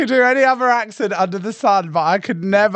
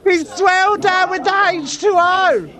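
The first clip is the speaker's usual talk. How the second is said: high, shouted commentary; high-pitched, gobsmacked yell; excited reaction; 13 semitones higher, 4 dB louder than usual. Real, not acted. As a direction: cry out in a high voice at the drama. Excited reaction to clip's row.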